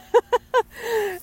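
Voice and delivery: giggling fit; high burst of laughter. Real, not acted. Laughing while telling prank.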